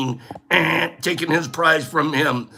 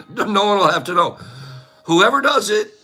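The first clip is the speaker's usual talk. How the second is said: ordinary talk, nothing marked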